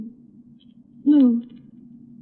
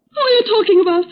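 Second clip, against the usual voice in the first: tearful cry; 7 semitones higher, faster than usual. Acted. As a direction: voice rising high, close to tears. Daughter distressed, crying out.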